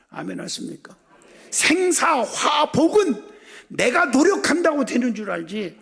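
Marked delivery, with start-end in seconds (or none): 1.5-5.8 high, loud proclamation, opening drawn out; full-force preaching. Real, not acted. Preacher correcting congregation about their own efforts, loud proclamation. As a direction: challenge the congregation with raised voice.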